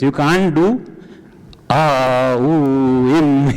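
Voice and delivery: "ah, ooh, im" sounded as three separate vowels; choppy separate syllables. Shown as a demonstration. Teacher shows the class the wrong way, choppy separate syllables. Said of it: "That's not how you do it".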